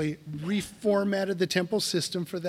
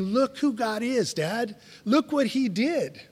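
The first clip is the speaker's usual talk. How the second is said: higher, bright-eyed kid's voice, lightly voiced; excited child; 6 semitones higher than usual. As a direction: play the delighted child showing Dad. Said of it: "Our kids are coming to us and saying".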